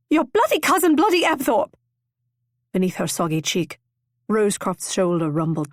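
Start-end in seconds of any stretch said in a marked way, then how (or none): 0.1-1.7 high, louder tearful curse; tearful fury. A narrator's reading. Constance to Rosecroft about his cousin, tearful fury. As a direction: curse him through tears. Weeping, furious at Apthorp.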